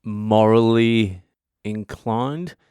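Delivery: slow, drawn-out morally inclined; mock careful search for words. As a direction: stretch morally inclined slowly, as if picking words delicately. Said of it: "Would you call it morally inclined".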